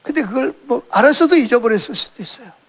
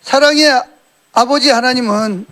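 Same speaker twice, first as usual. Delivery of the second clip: rising preaching; 6 dB louder than usual. Real, not acted. Preacher addresses the congregation.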